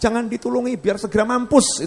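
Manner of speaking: high-pitched mock yell; retold crowd shout. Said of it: "udah teriak begitu kan kalimat yang kasar".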